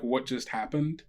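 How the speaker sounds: ordinary talk, nothing marked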